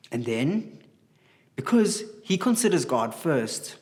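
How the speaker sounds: usual speaking voice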